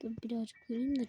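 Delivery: hushed whisper while hiding. Real, not acted. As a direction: whisper so nobody hears. Hiding, keeping quiet.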